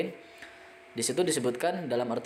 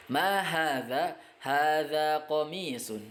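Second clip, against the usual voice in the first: Ma hadza rising slowly, then Hadza baitun falling; teacher drilling Arabic question and answer; 2 semitones higher, much slower than usual. Shown as a demonstration. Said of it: "question and answer drill".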